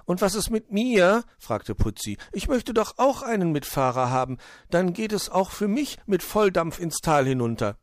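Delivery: high, insistent little voice; pleading squirrel. Acted. Putzi asks for a passenger too, high and insistent. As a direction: pipe up so as not to be left out.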